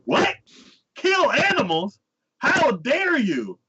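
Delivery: high, indignant, drawn-out protest; hammed-up offended hero; mock outrage. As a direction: act scandalized at the very idea. Outraged hero, acted in retelling.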